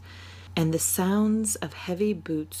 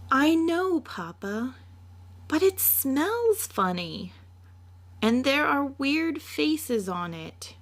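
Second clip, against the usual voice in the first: put-on a child protesting the weird carvings; little girl; 9 semitones higher than usual. Little Mina complaining to her papa about the smelly bed, in a high child voice.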